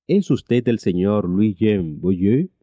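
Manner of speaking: ordinary delivery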